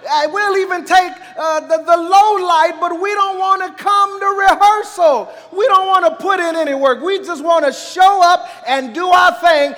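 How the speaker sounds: high, loud pulpit exhortation; full-force preaching. Real, not acted. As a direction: press the congregation hard, point after point.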